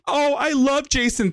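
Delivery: mocking gushing quote. Commentator mocks Shani's gushing, high and sugary. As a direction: parody her fake devotion. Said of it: "she's using this whole".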